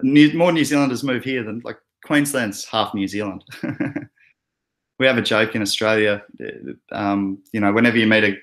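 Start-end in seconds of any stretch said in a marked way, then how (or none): none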